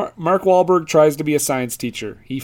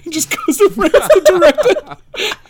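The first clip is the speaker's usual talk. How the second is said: words squeezed out through a high burst of laughter; laughing hard while telling the joke; 6 dB louder than usual. Real, not acted. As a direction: crack up mid-sentence, slow and loud. Laughing at his own joke.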